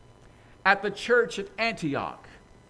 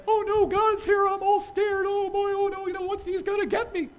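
high, loud, fast mock panic; mock scared; 14 semitones higher, 4 dB louder than usual. Preacher mocking fear of God, hammed-up panic. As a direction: ham up a cowering fearful believer. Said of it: "I don't walk around saying".

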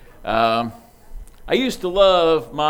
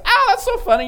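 put-on much higher, giggly child's voice; delighted kid; faster than usual. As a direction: squeal it like an amused little kid. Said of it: "I think it's".

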